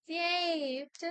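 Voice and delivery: high, drawn-out word; playful announcement. Real, not acted. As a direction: announce it in a cute singsong. Playful announcement of saved work.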